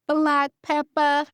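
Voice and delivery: high, loud catchphrase cry; imitating Miriam. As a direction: playfully mimic a cook's signature phrase. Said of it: "In the words of my girl, Miriam".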